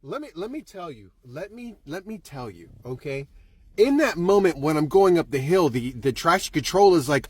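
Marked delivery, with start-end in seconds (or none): 0.0-3.3 very soft, drop right down after yelling; hushed confiding aside. Acted. Storyteller to audience before explaining, hushed aside. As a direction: lean in and confide it quietly.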